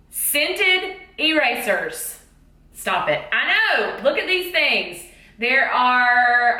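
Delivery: usual speaking voice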